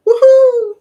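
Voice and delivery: high loud whoop; high loud celebratory woohoo; excited cheer. Real, not acted. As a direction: whoop triumphantly. Celebrating it working.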